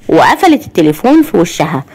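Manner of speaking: ordinary delivery, nothing marked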